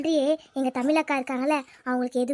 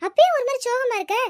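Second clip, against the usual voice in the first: put-on slightly raised, concerned village girl; worried friend; 7 semitones higher, 3 dB louder than usual. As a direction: coax her friend gently to talk.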